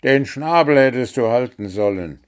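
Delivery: the gnome scolds the bird for talking, angry; angry snap. A narrator's reading. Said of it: "fuhr ihn Puh wütend an".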